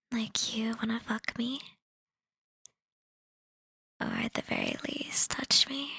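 breathy teasing whisper; seductive whisper. Acted. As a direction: tempt them in a whisper.

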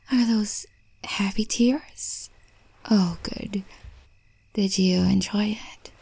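ordinary delivery

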